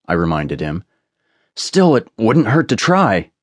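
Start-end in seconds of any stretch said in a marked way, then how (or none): 1.5-3.3 higher, drawn-out coaxing insistence; persistent. A narrator's reading. Oliver still wants to try the door, persisting. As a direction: keep pushing, slow and pitched up. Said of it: "Oliver persisted".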